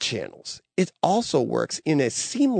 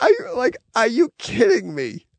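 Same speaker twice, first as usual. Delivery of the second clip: mock disbelief; 9 semitones higher than usual. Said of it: "I was like".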